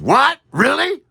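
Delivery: high, loud startled yelp; high-pitched outburst of mock disbelief; too loud. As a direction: blurt it far too loudly. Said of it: "A little too loud".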